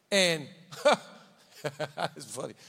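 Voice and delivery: usual speaking voice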